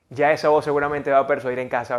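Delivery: ordinary talk, nothing marked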